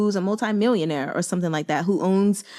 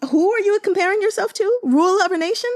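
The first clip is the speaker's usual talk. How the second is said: indignant; 13 semitones higher, 4 dB louder than usual. Real, not acted. Indignant rant at emperor.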